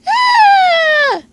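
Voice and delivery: sudden high shriek; startled scream. Acted. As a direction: jump and shriek. Startled by intruder.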